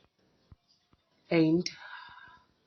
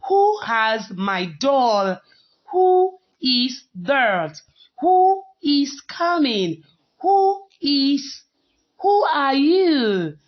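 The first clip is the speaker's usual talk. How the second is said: "who" stretched, slow and higher; slow example sentences; 4 semitones higher, 3 dB louder, slower than usual. Shown as a demonstration. Teacher modelling "who" questions for pupils. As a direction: read each example slowly. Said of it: "So here we have".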